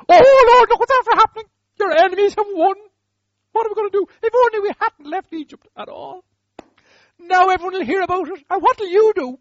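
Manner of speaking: loud, high hammed-up wailing, fading at the end; despairing wail. Defeated Joshua crying to God on the ground, hammed-up wail. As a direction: cry out to heaven in mock despair.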